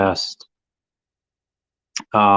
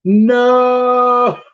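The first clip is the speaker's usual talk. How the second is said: shocked no; 6 dB louder than usual. Real, not acted. Shocked at the rescheduling message.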